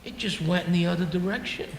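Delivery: low, soft, matter-of-fact doctor; very calm. Retold or imitated. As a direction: imitate a doctor calmly breaking news. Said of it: "said to me very calmly".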